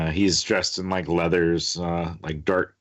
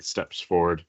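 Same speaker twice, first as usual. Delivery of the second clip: ordinary talk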